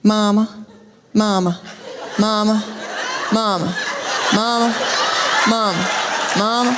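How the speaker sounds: lightly voiced child calling on repeat; dazed little child. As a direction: drone "mama" over and over, evenly spaced. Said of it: "sitting up there in a daze".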